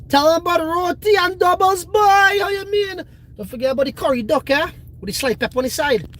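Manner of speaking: put-on boisterous Trinidadian accent, hyping up roti and doubles; Trini accent. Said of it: "That's my Trini accent".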